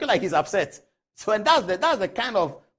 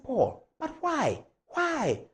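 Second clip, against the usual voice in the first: upset elder; 8 semitones higher, much slower than usual. Retold or imitated. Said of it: "He was quite upset".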